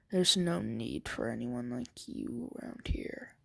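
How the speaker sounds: low, soft, unvoiced threat; quiet whispered judgment; cold whisper. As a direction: whisper it coldly, barely audible. Deku's cold verdict.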